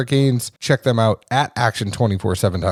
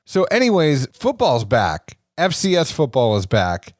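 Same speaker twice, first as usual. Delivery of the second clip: ordinary talk, nothing marked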